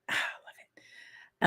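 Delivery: soft whispered aside; whispering. Real, not acted. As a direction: breathe it out in a whisper.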